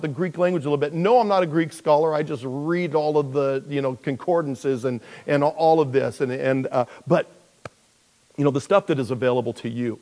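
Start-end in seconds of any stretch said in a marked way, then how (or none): none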